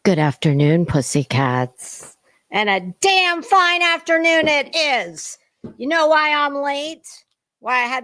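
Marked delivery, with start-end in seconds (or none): 0.1-2.2 booming, much lower, slow drawn-out hello; stretched hammed-up greeting. Host welcoming her viewers, a slow booming croon. 2.5-5.4 mock grand announcement. Mock grand opening to viewers.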